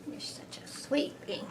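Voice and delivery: unvoiced, very soft aside; whispered. Real, not acted. Reader whispering to her therapy dog, tender.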